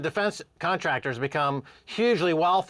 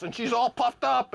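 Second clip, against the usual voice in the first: ordinary delivery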